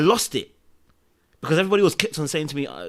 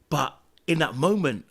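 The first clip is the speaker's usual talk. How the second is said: ordinary delivery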